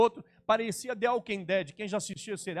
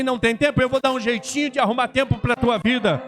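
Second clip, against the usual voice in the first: loud, building to a shout; God's stern answer; 4 semitones higher, 8 dB louder than usual. Retold or imitated. Preacher voicing God's reply to his excuses, a stern shout. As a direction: thunder it out as God's rebuke. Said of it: "Deus olhou pra mim e falou assim".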